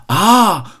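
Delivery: high, loud awed ah; crowd's awed ah. A narrator's reading. The crowd gazes up at the roof, loud awed ah. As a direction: gasp out an amazed ah. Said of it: "Die Menschen ... riefen".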